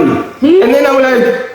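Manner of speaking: ordinary talk, nothing marked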